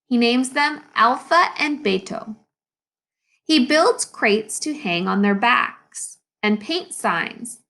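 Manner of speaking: usual speaking voice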